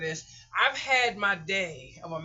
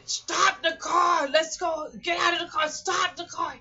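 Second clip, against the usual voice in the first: high, loud shout to the driver; urgent yelling; 10 semitones higher, 6 dB louder than usual. Retold or imitated.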